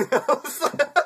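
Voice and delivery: high, breathless laughing fit; helpless laughter. Real, not acted. Storyteller cracking up retelling the insult. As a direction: crack up at the comeback.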